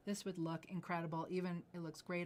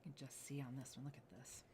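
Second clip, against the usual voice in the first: quick hushed whisper; 4 semitones lower, 9 dB quieter, faster than usual. Real, not acted. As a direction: murmur as if sharing a secret. Host leaning in to jade.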